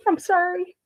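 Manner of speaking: breaking into tears after a long silence; a real tearful apology, voice cracking high; choked up. Real, not acted. Mother on a live show apologizing through real tears. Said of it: "Oh my God, I'm gonna cry".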